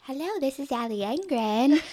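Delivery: bright professional phone greeting; customer service voice. Retold or imitated. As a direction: answer like a polished realtor. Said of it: "your real estate voice, your customer service voice".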